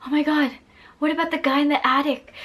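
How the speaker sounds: ordinary talk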